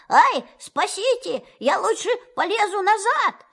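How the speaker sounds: high panicked cry for help, stuck in the hole. Acted. Pooh stuck in the rabbit hole cries out, high and panicked. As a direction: squeal it out, stuck and scared. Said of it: "Винни-Пух закричал".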